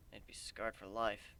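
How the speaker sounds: usual speaking voice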